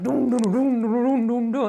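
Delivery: booming vocal drum beat; imitated drumming. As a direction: drum it out with your voice. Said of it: "I drummed like a freaking champ".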